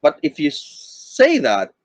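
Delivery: ordinary talk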